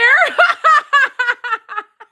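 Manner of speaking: high, long peal of laughter; real cackle. Real, not acted. Streamer amused by a viewer's comment, high cackling laugh. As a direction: cackle away, high and unrestrained. Laughs at her own remark.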